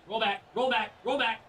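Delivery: loud pleading calls; shouting at the ball. Real, not acted. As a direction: yell at the ball to roll back. Urging the ball.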